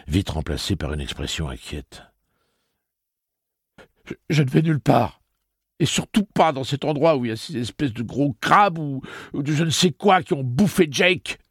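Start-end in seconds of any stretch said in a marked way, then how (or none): 4.0-11.4 high, loud stammering panic; panicked refusal. A narrator's reading. Eddie terrified, refusing to leave.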